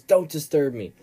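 loud mock yell at an assistant; retold scream. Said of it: "he screams at his assistant".

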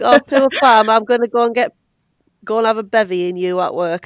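mock pity, laid on loud; cheeky mock-sympathy comeback to the cashier; sassy comeback. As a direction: sass her back with fake sympathy. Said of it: "She's gonna get sassed up and down from me".

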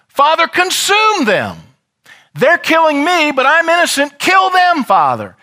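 very high, loud cry for vengeance; vengeful cry. Acted. Preacher imagines Jesus calling down judgement, shouting. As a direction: plead at full voice for their destruction.

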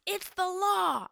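high-pitched mock scolding; drawn-out, high imitation of a yeller; imitated yelling. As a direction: insist on it like an indignant scold. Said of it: "yelling and spitting in our face".

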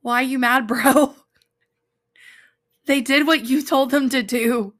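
laughing tease; teasing laugh. Retold or imitated. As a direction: poke fun at an angry friend. Teasing Jonah's anger.

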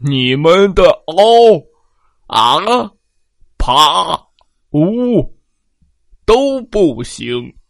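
loud, slow, booming giant; shouting giant. Acted. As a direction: bellow down from far above. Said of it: "喊道".

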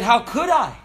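high, loud, incredulous question; Simba protesting. Acted. Lion son answering his father's ghost, high indignant protest. Acted reply of grown Simba.

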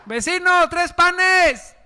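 high, sing-song begging call; pleading neighbor. Acted. Neighbor knocking at midnight, calling high and pleading.

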